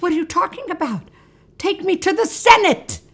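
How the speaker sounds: high-pitched, mock imperious demand; indignant Caesar. Caesar orders the steward to the Senate, indignant. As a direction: order him about, hammed-up.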